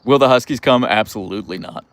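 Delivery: ordinary talk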